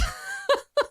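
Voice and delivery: high, cracked laugh of despair. Real, not acted. Player laughing at another failed roll, despairing laugh. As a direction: laugh it off in disbelief.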